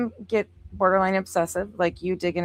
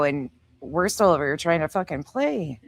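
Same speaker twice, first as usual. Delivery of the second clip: ordinary talk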